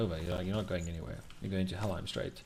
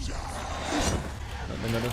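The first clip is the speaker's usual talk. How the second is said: panicked; 3 semitones higher, much slower than usual. Real, not acted. Taking damage in combat.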